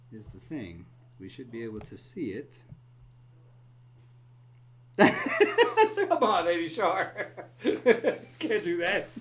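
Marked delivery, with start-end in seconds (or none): 5.0-9.1 talking through laughter; high and loud with laughs; laughing. Real, not acted. Laughing at Lady Shar's comment.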